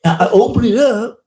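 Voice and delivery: high, stammering, awed retelling; breathless wonder. Retold or imitated. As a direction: tell it like a miracle just happened. Older man retelling his vision.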